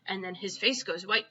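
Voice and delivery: plain reading voice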